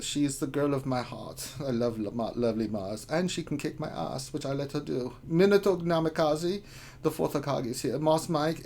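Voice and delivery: put-on leering, playful German guy accent; hammed-up, flirty German accent. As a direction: read the roll call in a suggestive German accent. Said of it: "as the German guy".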